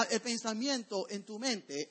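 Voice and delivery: ordinary delivery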